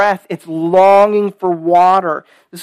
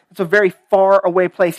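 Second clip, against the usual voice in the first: ordinary talk, nothing marked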